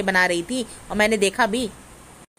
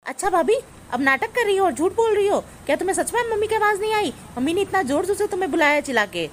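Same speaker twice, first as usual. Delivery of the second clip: high-pitched indignant accusation; accusing; 8 semitones higher than usual. Acted. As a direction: challenge the lie in a raised pitch. Sister-in-law accuses bhabhi of lying.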